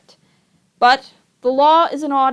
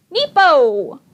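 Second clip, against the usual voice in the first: put-on high, loud baby call; baby's cry out; 8 semitones higher, 5 dB louder than usual. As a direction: shout it like a toddler. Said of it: "Sunny's unmistakable voice rang out".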